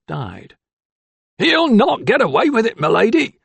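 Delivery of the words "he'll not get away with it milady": high, loud rough groom's yell. A narrator's reading. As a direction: shout it across the field. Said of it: "yelled the pockmarked groom".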